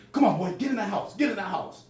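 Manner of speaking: yelling mom. Retold or imitated. Mom ordering son in before watching friends, high retold yell. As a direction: holler like a mother ordering her boy inside.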